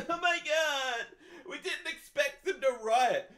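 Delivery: high, slow mock official voice; hammed-up clueless official, slowly. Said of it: "they said".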